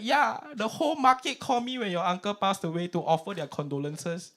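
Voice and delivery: lightly voiced high auntie; a hint of matter-of-fact auntie; godma voice. Godma explaining she gave the market the number, light auntie voice.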